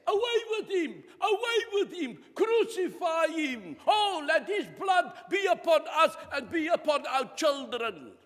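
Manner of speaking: high, loud mob baying for execution; angry crowd shout. Acted. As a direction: shout it as a crowd demanding a death. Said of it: "What did they say?".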